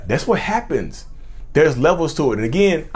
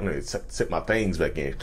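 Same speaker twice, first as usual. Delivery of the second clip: lower, softer sly vampire aside; sneaky vampire; 8 semitones lower, 8 dB quieter than usual. Retold or imitated. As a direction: mutter it like a vampire hiding fangs. Vampire narcissist voiced.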